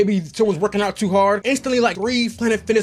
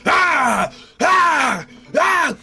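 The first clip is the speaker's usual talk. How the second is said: high, loud straining yells of a lifter; imitated lifting grunts; 9 semitones higher, 4 dB louder, much slower than usual. Imitating his friend mid power clean, loud high straining gym yells. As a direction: mimic a guy maxing out on the bar.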